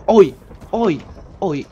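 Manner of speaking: short, loud, high cries of surprise; startled yelps. Real, not acted. Gamer jumping at creatures in the village, startled yelps. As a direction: yelp "uy" as each shock hits.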